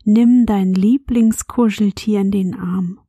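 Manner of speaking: bedtime whisper. Acted. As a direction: whisper gently as if tucking a child in. Host whispers bedtime intro.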